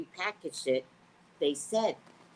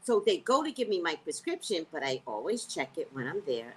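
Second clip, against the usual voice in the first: ordinary talk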